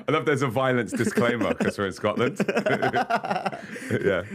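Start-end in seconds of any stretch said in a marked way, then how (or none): 2.4-3.9 high burst of laughter. Real, not acted. Laughing at violence disclaimer joke.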